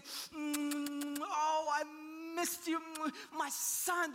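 high, loud, effusive kissing noises and words; smothering with kisses. Acted. Father kissing his returned son, gushing. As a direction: gush between big acted kisses. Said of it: "The word is a repeated kiss".

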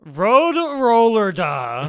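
slow drawn-out villain yell; Dio shout. Retold or imitated. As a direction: bellow it like a villain. Quoting Dio's famous line.

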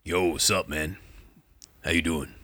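casual dude greeting in a put-on voice; laid-back orc blacksmith. Shown as a demonstration. DM demoing an orc blacksmith, easygoing dude voice. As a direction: greet like a chill orc buddy. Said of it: "orc blacksmith is like".

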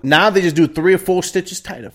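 normal talking voice

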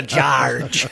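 George drawn out like a pirate; pirate-like accent. Retold or imitated. Showing what the accent turns into, pirate-like George. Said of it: "It sounds like a pirate".

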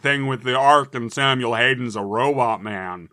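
slow, loud, hammy lore reading; bad reading voice. Shown as a demonstration. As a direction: read lore in a silly voice. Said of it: "worst voice I can think of".

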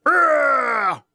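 hammed-up Hulk yell; Hulk roar. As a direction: roar like a kid playing the Hulk. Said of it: "and went".

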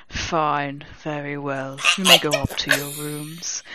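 low, measured grudging professor; resigned, restrained dismissal; Professor Snape. A narrator's reading. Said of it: "Professor Snape said".

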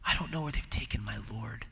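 whispered, grieving, barely there; sorrowful whisper. Acted. Preacher whispers grieving Mary's words to the congregation, hushed. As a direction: breathe it out like a weeping woman.

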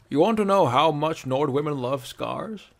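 playful setup. Acted. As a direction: tease him into the punchline. Bracknell setting up a joke.

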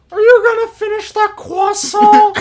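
high, slow nasal-kid cartoon voice; Carl Weezer. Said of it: "I gotta do Carl Weezer".

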